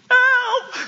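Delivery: hoge, harde angstkreet om hulp; paniekerige hulpkreet. Retold or imitated. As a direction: gil het uit alsof er een baksteen aankomt. Bedreigd door man met baksteen. (angstig gillen om hulp)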